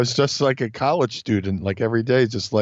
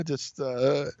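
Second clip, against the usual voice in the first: ordinary talk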